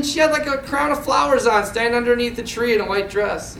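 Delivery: ordinary delivery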